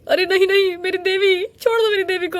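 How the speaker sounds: high loud frantic plea; desperate pleading. Acted. Mother pleading with witch for her daughter, high desperate. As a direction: beg the witch to let go. Parents begging witch release child.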